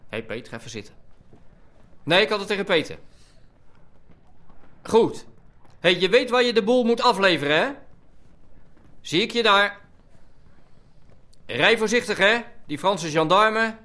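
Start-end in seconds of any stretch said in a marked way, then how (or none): 2.0-13.7 loud, high shouting into a bad line; calling Hans over a crackling phone, shouting. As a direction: yell so the far end can hear you.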